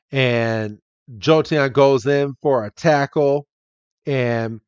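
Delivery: ordinary delivery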